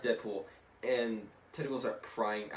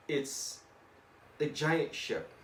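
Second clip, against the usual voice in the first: ordinary talk, nothing marked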